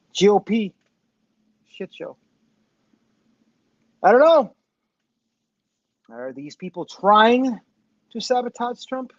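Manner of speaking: normal talking voice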